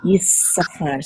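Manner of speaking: "Yisachar" slowed on a long hissed s; held double s. Shown as a demonstration. Teacher repeating the doubled letter for learners, long s in "Yisachar". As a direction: stretch the s in "Yisachar". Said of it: "so you hold it a little bit".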